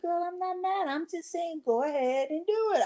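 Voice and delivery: high, light mock-innocent voice; mock reassuring. Telling her friend she isn't mad. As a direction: raise the pitch, play it innocent. Retelling her own reply.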